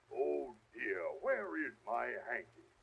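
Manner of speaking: ordinary delivery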